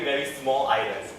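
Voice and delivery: ordinary delivery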